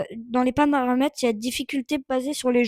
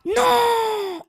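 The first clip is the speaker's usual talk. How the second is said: much higher yelled no; anguished no; 11 semitones higher, 4 dB louder than usual. Real, not acted. Gamer crying out at the screen as his shot misses, high yelled no. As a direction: cry it out as the shot fails.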